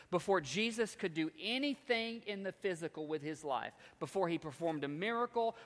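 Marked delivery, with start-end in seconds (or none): none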